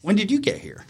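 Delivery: imitating mom's high-pitched surprise; surprised greeting. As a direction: act out mom as if he just appeared. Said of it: "it was like a light switch".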